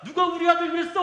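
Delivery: high, loud protective dad demanding who did it; angry father. Retold or imitated. Preacher imitates the neighbor's father confronting the boys. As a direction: bark it like a furious father defending his son. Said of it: "아버지가".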